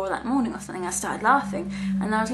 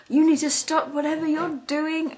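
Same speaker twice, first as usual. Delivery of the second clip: higher, louder stern teacher voice; 6 semitones higher, 4 dB louder than usual. Retold or imitated. Teacher telling the innocent boy off, higher and stern. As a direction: tell the boy off sharply. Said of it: "was like".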